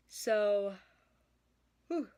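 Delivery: words stretched by a yawn. Real, not acted. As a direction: let a yawn take over the word. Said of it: "I don't know where that came from".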